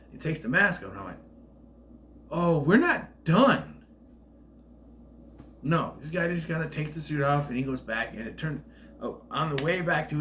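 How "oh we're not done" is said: slow, loud, higher-pitched dawning surprise; surprised realization. Retold or imitated. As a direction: draw it out as the twist lands. Acted surprised reaction to twist.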